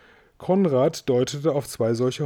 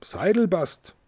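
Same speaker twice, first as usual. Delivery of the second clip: mild, higher-pitched call; 5 semitones higher, slower than usual. A narrator's reading. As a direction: call him by name through a window, without real volume. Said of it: "ans Fenster und rief".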